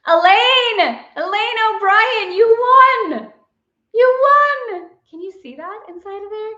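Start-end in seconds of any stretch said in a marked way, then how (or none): 0.1-4.9 high, loud winner call; excited announcement. Real, not acted. Announces the giveaway winner to viewers, high and loud.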